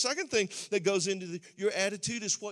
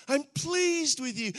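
higher and louder, "pleased" ringing out; proclaiming Father; 7 semitones higher, 4 dB louder, slower than usual. Retold or imitated. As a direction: announce it with joy. Father's words, said softly then strongly.

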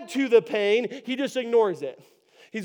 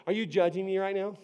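ordinary talk

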